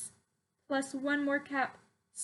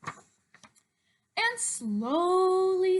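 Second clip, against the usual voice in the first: drawn out; 4 semitones higher, 6 dB louder, much slower than usual. A narrator's reading. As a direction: stretch slowly very slowly. Reading story, drawn out word.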